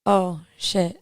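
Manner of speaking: usual speaking voice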